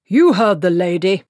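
loud, commanding; firm dismissal. Acted. Cutangle turning back to Treatle, loud and commanding. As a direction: put him firmly in his place.

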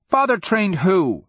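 high, loud little-brother outburst; indignant, high-pitched demand; jealous cry. A narrator's reading. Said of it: "Kelmomus cried".

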